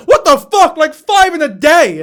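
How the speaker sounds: loud, high-pitched disbelieving shout; incredulous outburst. Real, not acted. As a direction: burst out in exasperated disbelief. Outraged at how often she posts.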